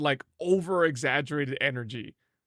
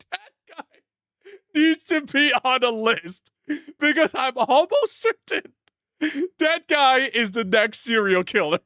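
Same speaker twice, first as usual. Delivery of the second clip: shocked and laughing; 9 semitones higher, 3 dB louder than usual. Real, not acted. Repeating in shock.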